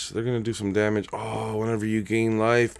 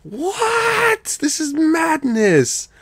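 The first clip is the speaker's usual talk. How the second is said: way higher, hammed-up shock, madness drawn out slowly; mock disbelief; 4 dB louder, much slower than usual. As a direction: play a theatrical fit of disbelief. Fake outrage at the draft.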